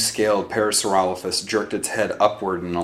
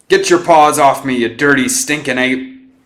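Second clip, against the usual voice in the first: big, much louder movie-hero yell, slowing on stinking ape; Charlton Heston shout; 5 semitones higher, 11 dB louder than usual. Acted. Biggs quoting a movie at his teammate in a loud hammed-up shout. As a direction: roar it like a hammy film hero. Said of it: "Biggs went into Charlton Heston mode".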